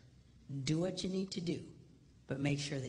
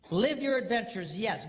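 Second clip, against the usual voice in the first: higher and louder, preaching at full force; preached loud; 7 semitones higher, 8 dB louder than usual. Acted. Preacher repeating his charge to the boys, loud preaching. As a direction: drive it home to the crowd.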